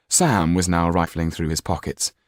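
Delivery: usual speaking voice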